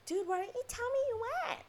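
high, squealed accusation; high-pitched mock outrage at a friend; retold indignant complaint. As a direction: whine it in exasperated disbelief. Acts out confronting guy who texted.

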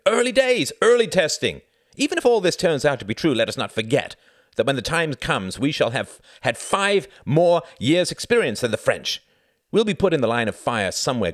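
loud, high, easing as it goes; shouted tirade. A narrator's reading. Pilot lecturing his doubting comrades, heated shout. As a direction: start at a shout, keep arguing forcefully. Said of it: "shouted Jorgen".

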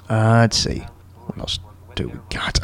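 barely voiced, under the breath; muttered to self. Real, not acted. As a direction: mumble it to yourself while searching notes. Muttering to himself, looking through notes.

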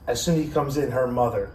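usual speaking voice

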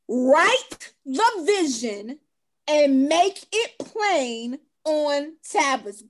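"Write the vision and make it plain on tablets" read very slowly, each word weighted. Shown as a demonstration. Rereading the verse for the people in the back, very slowly. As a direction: stretch every word out so the back row hears it. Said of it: "read that one more time for me in the back".

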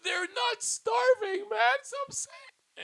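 very high, drawn-out squeal; squealed protest. Acted. Guest defends his agents to teasing hosts, squealed protest. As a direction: protest slowly, way up in falsetto.

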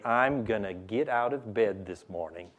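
low, soft self-talk on waking; quiet resolve. Acted. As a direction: say it quietly to yourself. Models quiet morning self-talk.

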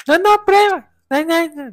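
loud, very high squeaky mimicry; mocking her imitation. Boyfriend mocks her version of his voice, squeaky parody. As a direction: throw it into a squeal to tease. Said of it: "No, no, no hablo así".

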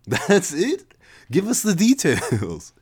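Levi laughing. Acted. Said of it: "Levi laughed".

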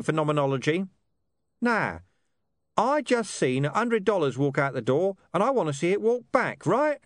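high-pitched, colloquial talking-dog voice; Gaspode, streetwise and brusque. A narrator's reading. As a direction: rattle it off as a cocky street mutt.

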